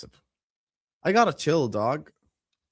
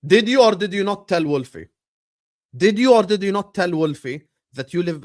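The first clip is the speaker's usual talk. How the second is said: louder, higher demanding question; mock interrogation; 4 semitones higher, 6 dB louder than usual. Real, not acted. Streamer grilling a friend about his skyline claim, mock demanding. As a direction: press him like a lawyer.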